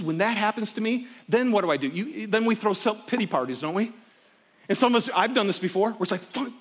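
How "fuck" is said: muttered curse. Retold or imitated. Muttered frustration walking out.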